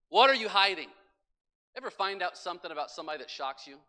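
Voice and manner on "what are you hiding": same question pushed much louder; preacher driving the question into the congregation, louder; louder repeat. Acted. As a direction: hammer it home the second time. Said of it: "repeated louder".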